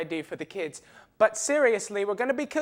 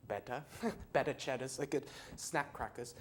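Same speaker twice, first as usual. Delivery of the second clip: normal talking voice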